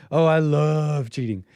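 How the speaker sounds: slow, drawn-out parody in a put-on voice; mock viral quote. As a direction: mimic her, stretching each word.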